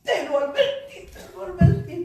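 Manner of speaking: mock angry reproach, rising; scolding parent. A hint of an angry parent scolding the child. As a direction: scold the child who fell. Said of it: "hay una voz de ira".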